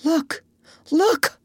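high, urgent calling out; two high, excited cries; sudden call. A narrator's reading. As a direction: call attention sharply, pitch jumping up. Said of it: "the doctor suddenly called out".

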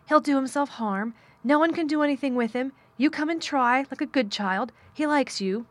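high, pressing plea for help; urgent, hurried. A narrator's reading. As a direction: plead in a great hurry. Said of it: "she said in a great hurry".

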